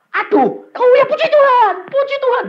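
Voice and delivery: high, loud mock wail of praise; imitated bawling. Woman with bellyache praising God, acted bawling. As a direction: bawl it out like someone in pain. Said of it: "Gembor-gembor".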